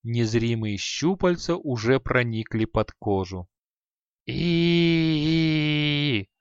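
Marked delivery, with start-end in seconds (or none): 4.2-6.3 long drawn-out terrified scream; frantic shriek. A narrator's reading. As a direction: shriek in pure panic. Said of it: "раздался истошный визг".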